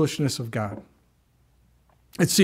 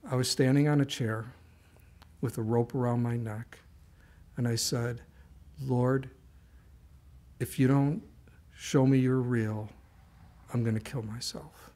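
grave confession; 2 semitones lower, 6 dB quieter than usual. Acted. Man confessing his suicide attempt.

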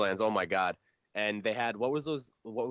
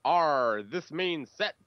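pirate voice; 8 dB louder, slower than usual. Pretend. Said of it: "Okay, pirate voice now".

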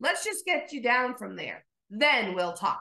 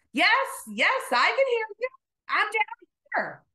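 calling up; 10 semitones higher than usual. Acted. Jack calls up from branch.

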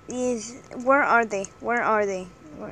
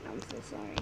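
quiet apology; 18 dB quieter, much faster than usual. Real, not acted. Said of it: "I didn't mean to say that".

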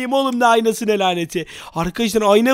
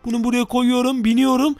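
ordinary talk

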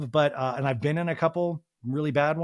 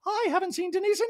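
Dorit impression. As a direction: act shocked in a squeaky put-on voice. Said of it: "Dorit in a talking head goes".

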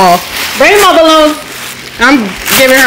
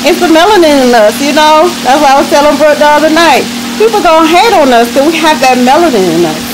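ordinary delivery

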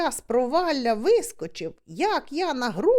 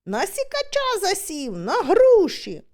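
shouting; 7 semitones higher, 4 dB louder than usual. Retold or imitated. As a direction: shout the news down from the tree. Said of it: "кричу".

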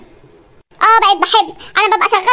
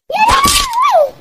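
high drawn-out wail, slow; wailing cry; 14 semitones higher, much slower than usual. Acted. Wife wails as her husband hits her, high slow wail. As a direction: wail it out like a woman in despair.

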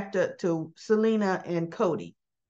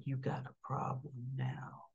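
quiet threat; 8 semitones lower, 14 dB quieter than usual. Retold or imitated. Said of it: "Selena looks at him like".

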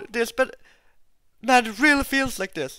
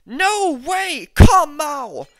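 frustrated outburst; 2 semitones higher, 7 dB louder, slower than usual. Real, not acted. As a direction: cry out in real exasperation. Glass placed wrong while building.

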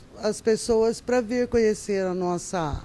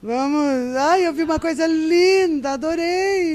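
higher, louder playful gushing over a gift; mother acting out a hint to her son, higher and louder playful gushing; mock gushing hint; 6 semitones higher, 4 dB louder than usual. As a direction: gush loudly about a lovely gift so the son takes the hint.

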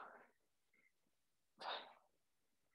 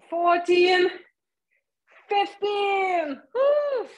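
building shout; 6 semitones higher, slower than usual. Real, not acted. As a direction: push the final reps up to a cheer. Last reps, shouting count.

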